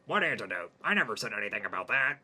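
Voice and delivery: eerie alien friend asking. Acted. Jimmy the alien asks Hayden about dying.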